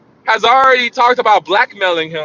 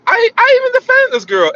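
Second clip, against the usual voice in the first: high-pitched whiny friend complaint; 3 dB louder than usual. Retold or imitated. Friend whining that he defended the girl. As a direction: whine like the wronged friend. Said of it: "he's like".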